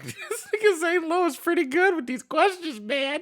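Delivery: very high-pitched laughing squeal; amazed laughter. Real, not acted. Reactor impressed by interviewer's question, laughing in amazement. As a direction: talk through high, incredulous laughter.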